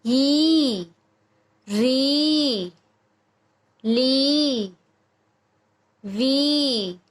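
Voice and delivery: each syllable, ई to ही, with a long held ee; long vowel drill. Shown as a demonstration. Teacher drilling consonants with the big ee sign, long vowels. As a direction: draw out री, ली, वी one by one on the ee. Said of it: "इसका उच्चारण थोड़ा लंबा और ऊंचा बोला जाएगा".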